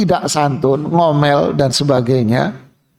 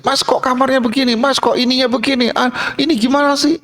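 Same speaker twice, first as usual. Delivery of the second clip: higher, fast, rising to a shout; angry hotel guest; 8 semitones higher, faster than usual. Acted. Guest phoning reception to complain, fast and shouting. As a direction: fire complaints down the phone.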